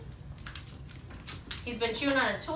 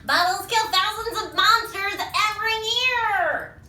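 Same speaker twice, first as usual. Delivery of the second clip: high, loud panicked proclamation; alarmed shouting; 9 semitones higher, 7 dB louder than usual. Acted. Monster warning others about deadly bubbles, high alarmed shout. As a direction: shout the dire warning, hammed-up.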